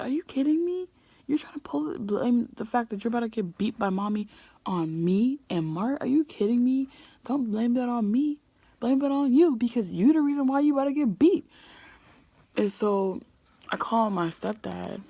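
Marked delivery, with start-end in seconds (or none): 0.0-11.5 higher-pitched indignant scolding; indignant retort. Retold or imitated. Sister refusing blame for sibling's punishment, indignant retort. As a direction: retell telling off a sister in exasperated disbelief. Retold quarrel with younger sister.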